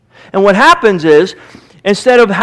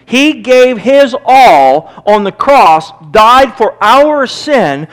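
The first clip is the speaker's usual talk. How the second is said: slowed right down, each word weighted; slow and weighty; 4 semitones higher, 4 dB louder, much slower than usual. Real, not acted. Preacher telling the church what Christ gave, slow and weighty. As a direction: draw it out so the cross sinks in.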